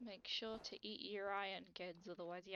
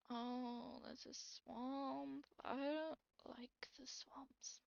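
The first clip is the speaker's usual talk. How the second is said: slow whispered dislike; 4 semitones higher, much slower than usual. Acted. Dislikes swamps.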